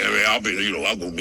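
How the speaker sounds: low, mocking Southern voice; Southern accent. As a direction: imitate a Southerner in a low register. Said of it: "You so Southern".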